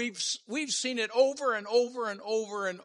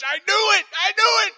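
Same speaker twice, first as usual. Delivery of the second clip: excited certainty; 13 semitones higher, 12 dB louder than usual. Retold or imitated. Retelling certainty, voice leaps.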